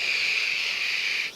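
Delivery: long imitated sizzle; sizzling noise. Seeker boiling inside on a hot day, long sizzle. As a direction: hiss like a frying sausage. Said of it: "a frying sausage or something".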